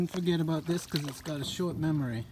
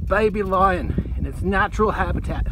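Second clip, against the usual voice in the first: Aussie croc hunter host voice; nature documentary; 2 semitones lower, 4 dB louder than usual. Pretend. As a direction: narrate like an excited wildlife presenter. Steve Irwin style wildlife host bit.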